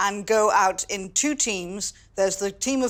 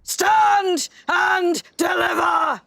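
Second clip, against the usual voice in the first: high, loud, slow theatrical cry; highwayman's shout; 10 semitones higher, 7 dB louder, much slower than usual. Acted. Storyteller repeating the highwayman's command, drawn-out shout. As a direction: shout it word by word, stretched out. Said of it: "What does she keep shouting?".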